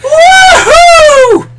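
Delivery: high excited woohoo, shouted with a laugh; imitating Dad Cube woohoo. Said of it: "Doesn't he do that noise where it's like".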